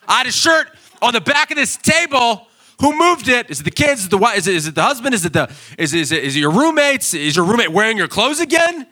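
high, loud frantic yelling over a missing shirt; cranky yelling. Retold or imitated. Preacher mimics a cranky man yelling at his household, hammed-up. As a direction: yell like a cranky morning grump.

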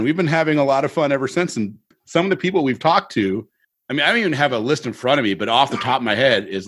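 ordinary talk, nothing marked

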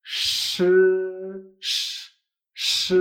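SH as a sustained hush; retroflex sh. Shown as a demonstration. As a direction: shush steadily on SH. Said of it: "Y S H".

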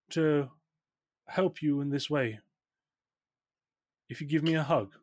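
milk every pause as the hurt mother; martyred mother. Acted. Mother asks daughter for one hug, martyred mother.

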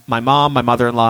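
ordinary talk, nothing marked

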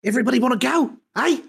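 lightly voiced Aussie, playing it up; Australian accent. Friends joking about a crime show, mock Australian accent. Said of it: "my Australian accent needs work".

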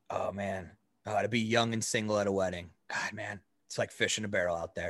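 put-on low, slurry drunk boss voice. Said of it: "he's kinda drunk now".

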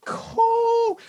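very high, excited cool; squeaky cool. Retold or imitated. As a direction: squeal it, barely containing the excitement. Mock nonchalant reply, now hyped.

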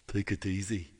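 lightly imitated film character's speech; My Left Foot lead impression. Singer imitating the lead in My Left Foot, light impression. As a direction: take on a hint of the film character's voice. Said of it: "that's the way he talked".

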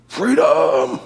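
strained, held-back scream; primal cry. Shown as a demonstration. Preacher showing the cry God created, restrained primal scream. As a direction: demonstrate a deep primal cry without full volume. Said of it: "this deep, primal".

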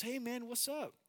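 high, upbeat buddy greeting; friendly greeting. Retold or imitated. Friend hailing a pal at the airport, high friendly greeting.

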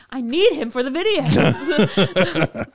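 slow, loud, laughing through it. Real, not acted. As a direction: giggle out the excuse. Joking excuse while laughing.

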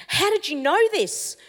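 high, astonished question; shocked young guy asking how; amazed disbelief. Retold or imitated. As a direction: voice him stunned, pitch leaping up. Tattooed guy stunned by prophecy.

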